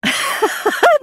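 a breathy, high scornful laugh; high mocking laugh. Real, not acted. Amused at being accused of faking hauntings, high scoffing laugh.

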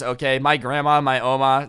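ordinary talk

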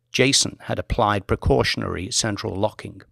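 ordinary delivery

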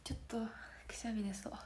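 whisper. Real, not acted. About to sneeze.

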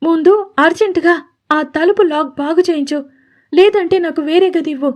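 snapping out her demand, lightly voiced; fed-up irritation. A narrator's reading. Radhika fed up, telling Gauri to fix the lock, irritated. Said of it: "చెప్పలేనంత చిరాకుతో".

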